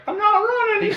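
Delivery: very high squeaky sloth voice. Retold or imitated. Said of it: "he's like".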